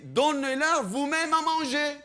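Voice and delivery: loud, high, insistent order on "Donnez-leur vous-même"; emphatic command. Acted. Jesus answering his disciples, loud emphatic command on "Donnez-leur vous-même". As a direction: drive home "vous-même à manger" at full volume. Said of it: "il voulait mettre l'accent en leur disant".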